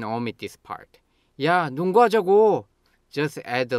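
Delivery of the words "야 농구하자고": high, loud demand; angry. Shown as a demonstration. Repeating the invitation to an unresponsive friend, angry. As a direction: insist loudly, stretching the final 고. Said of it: "Then you get angry and say".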